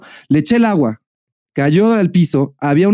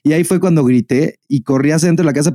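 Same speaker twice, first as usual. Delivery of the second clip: usual speaking voice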